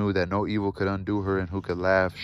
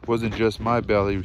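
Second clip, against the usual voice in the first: ordinary delivery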